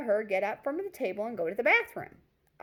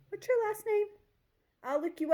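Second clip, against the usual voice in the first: eager awkward ask; 13 semitones higher than usual. Retold or imitated.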